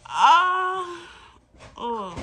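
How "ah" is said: high, loud anguished cry; loud high cry of frustration; frustrated cry. Real, not acted. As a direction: let out a loud pained wail. Cries out in frustration.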